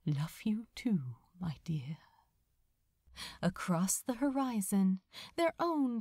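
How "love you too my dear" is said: soft tender whisper, fading; whispered reply. Acted. Aziraphale answering Crowley's love at the end, tender whisper. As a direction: whisper it back gently. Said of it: "whispered his reply".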